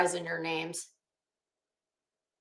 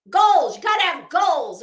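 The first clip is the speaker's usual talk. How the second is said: emphatic; 8 semitones higher, 11 dB louder than usual. Real, not acted. Joking about her goals.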